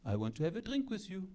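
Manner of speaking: soft, quick French-accented come-on; hushed French seducer; sexy French accent. Shown as a demonstration. Frenchman asks for a drink, soft French accent. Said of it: "Like a French accent".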